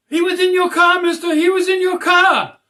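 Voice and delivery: put-on high-pitched, loud kids' chorus; imitated chirping yell. Little kids telling the dog's owner about the thief, imitated chirping yell. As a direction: yell it out like excited little kids, far above your own voice. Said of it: "they start yelling, like, chirping, yelling".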